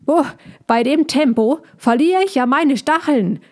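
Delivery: high-pitched wail of complaint; hedgehog crying out. A narrator's reading. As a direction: cry out as you're jolted along. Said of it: "rief er auch immer wieder".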